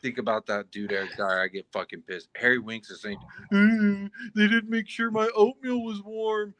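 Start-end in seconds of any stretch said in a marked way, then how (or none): 3.5-6.5 high whiny mock voice. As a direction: whine like a spoiled player. Mocking Harry Winks whining.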